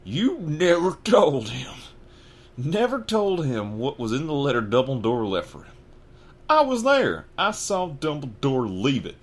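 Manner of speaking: slow, loud, high furious accusation; every syllable shaking with rage; trembling rage. A narrator's reading. Hagrid accusing Vernon of hiding the letter, furious. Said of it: "every syllable trembled with rage".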